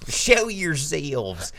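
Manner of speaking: high, very drawn-out mock Southern; hammed-up Southern movie drawl; bad Southern accent. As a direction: stretch it into a silly Southern holler. Repeats the bad movie Southern line.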